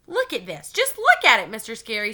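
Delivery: loud, high complaining whine; grousing. A narrator's reading. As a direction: whine it to the teacher. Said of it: "she groused".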